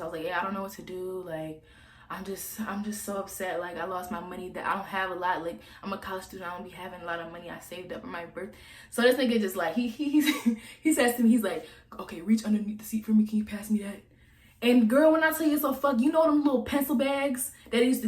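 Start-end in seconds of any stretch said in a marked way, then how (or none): 0.2-8.5 soft, put-on stressed voice; fake stressed. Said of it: "I was talking like I was stressed". 12.0-14.0 hushed whisper. Retold or imitated. Handing over secret cash.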